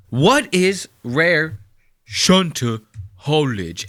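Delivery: drawn out slowly, each word weighed; asking the video maker again, slow and deliberate; slow emphatic question. Real, not acted. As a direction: spell out the confusion slowly. Demands the term's meaning.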